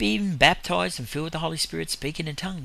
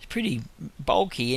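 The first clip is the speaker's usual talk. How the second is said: ordinary talk, nothing marked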